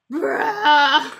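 loud exasperated shout; shouted bruh. Pretend. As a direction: yell it in disbelief. Voicing the drawn character.

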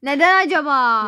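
loud, pointed retort; irritated. Acted. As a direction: throw it back loudly and crossly. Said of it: "Biraz sanki sinirli". (sinirli, yüksek sesle çıkışma)

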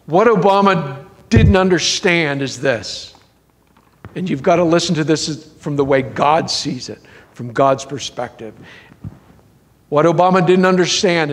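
normal talking voice

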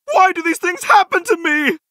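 high-pitched woe-is-me complaint; whiny lament. Acted. Game hero bemoaning bad luck, high and plaintive. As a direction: wail it in self-pity.

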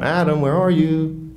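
high, slow calling out; God calling Adam. Acted. God calls out high and slow to hiding Adam. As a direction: call out slowly and high across the garden, searching.

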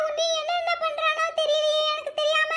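usual speaking voice

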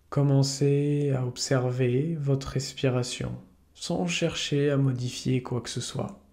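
calm yoga nidra guide, unhurried with long pauses; soothing meditation voice; guided meditation. Acted. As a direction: lead listeners gently into relaxation, leaving space between phrases.

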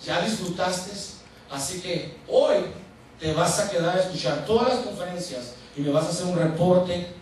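strict boss dictating orders; stern pastor. Retold or imitated. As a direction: hand down the punishment firmly. Strict pastor voiced scolding.

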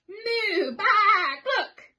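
high loud moo and cluck; animal cries. A narrator's reading. Animals shout at the farmer from under the bed. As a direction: cry out like startled animals.

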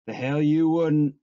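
forceful scoffing comeback; scornful contradiction. A narrator's reading. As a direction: shoot him down scornfully. Rabbit contradicts Lenny in quarrel.

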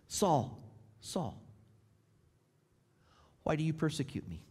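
quiet, falling voice of Jesus. Retold or imitated. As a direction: ask it softly, as if grieved. Voice of Jesus, softer repeat.

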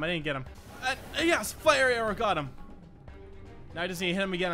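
ordinary talk, nothing marked

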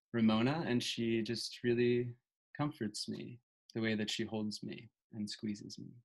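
normal talking voice

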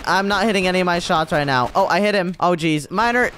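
ordinary delivery

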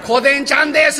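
high, loud shouted correction. Acted. Geisha loudly correcting her name to the master. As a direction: bark the name back loudly. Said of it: "えらい大きな声で".

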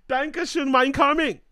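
put-on high, loud German accent. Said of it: "In my German accent".

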